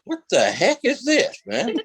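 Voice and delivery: high, astonished what-the-heck; retold disbelief. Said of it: "I'm like".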